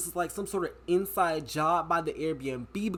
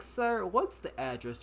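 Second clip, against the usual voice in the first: slow, unhurried operator drawl; a 911 dispatcher to the frightened caller, slow and unbothered; mock slow dispatcher; slower than usual. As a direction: imitate a maddeningly calm dispatcher. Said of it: "He's talking so slow".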